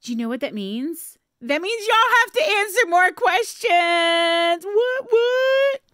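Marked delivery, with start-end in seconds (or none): 1.4-5.8 high, loud and gleeful; excited. Real, not acted. Host teasing viewers about more questions, excited high shout. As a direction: crow the news with a loud high cheer.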